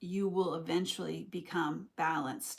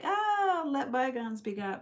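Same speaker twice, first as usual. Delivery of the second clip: mock forgiving; 6 semitones higher, 4 dB louder than usual. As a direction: breezily wave it all away, hammed-up. Acts out forgiving one day.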